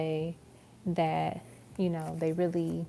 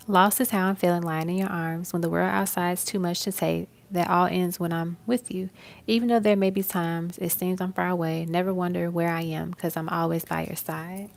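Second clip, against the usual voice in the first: louder, heartfelt reading of a love song; dramatic lyric recitation; 6 dB louder than usual. Acted. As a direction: read it like a spoken love poem. Recites [name] lyric.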